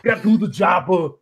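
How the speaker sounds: mock Austrian action-hero accent; Arnold. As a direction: echo the Austrian-accented battle order.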